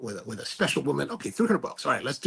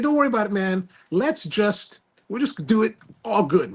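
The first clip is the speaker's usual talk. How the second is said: eager reassurance; 6 semitones higher than usual. Retold or imitated. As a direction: wave his worry away, brightly. Reassuring cousin, upbeat.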